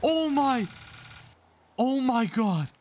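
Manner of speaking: high, slow, drawn-out cry of shock; shocked scream. Real, not acted. Car crash in game.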